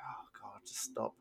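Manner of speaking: breathy exasperated whisper under the breath; weary whispered plea; whispered groan. Retold or imitated. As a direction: whisper it like you're begging the film to end. Groaning reaction to movie twists.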